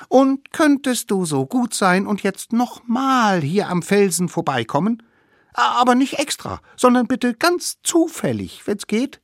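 high, wheedling request; little savages calling. A narrator's reading. Little savages asking mammoth to pass by again, high calling. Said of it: "riefen die kleinen Wilden".

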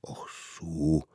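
soft whispered reply. Acted. Mammoth answers the hunter in a whisper. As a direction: breathe it.

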